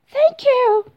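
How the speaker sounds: very high, loud and grateful; elated thanks. Acted. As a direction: squeal your thanks with delight. Thumbelina gratefully thanks the bluebird.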